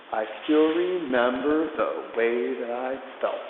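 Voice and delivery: slow, drawn-out lyric line; slow spoken lyric. Shown as a demonstration. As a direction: stretch the lyric out to the count. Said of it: "I'm just gonna speak the lyrics".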